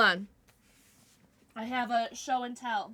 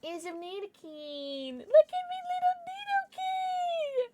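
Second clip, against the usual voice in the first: squeaky cooing; slower than usual. Real, not acted. Streamer doting on a Nidoking plushie, squeaky baby talk. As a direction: climb into a slow, squeaky coo. Coos over plushie, near tears.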